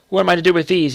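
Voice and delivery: high, animated exclamation; crying out. A narrator's reading. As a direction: call it out in lively bewilderment. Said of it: "he cried".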